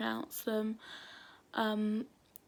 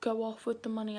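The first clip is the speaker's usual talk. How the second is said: ordinary talk, nothing marked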